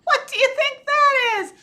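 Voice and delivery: high, loud question; squealed, amazed question; excited alarm. Pretend. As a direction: gasp it out to young listeners. Reacting to the dragon picture.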